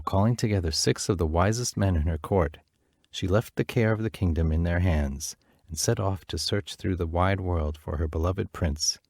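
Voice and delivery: usual speaking voice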